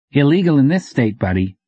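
a waitress relishing laying down the law; smug threat. A narrator's reading. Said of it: "pleased at any opportunity to threaten somebody with the law".

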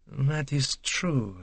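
slow, soft, absent-minded agreement; distracted, drawn-out murmur; absently. A narrator's reading. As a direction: draw it out quietly, thoughts elsewhere. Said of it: "said Poirot absently".

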